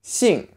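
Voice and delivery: "Xing" starting high and falling sharply. Shown as a demonstration. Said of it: "say it with a falling tone".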